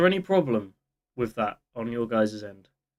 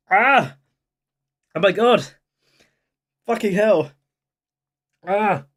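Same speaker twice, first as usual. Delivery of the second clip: loud, high pained yelps; pained shouting; 7 semitones higher, 6 dB louder than usual. Real, not acted. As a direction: yell out from the burn of the drink. Burning throat after downing alcohol.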